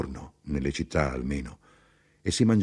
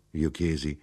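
ordinary delivery, nothing marked

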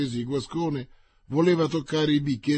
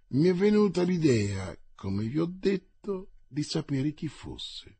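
ordinary delivery

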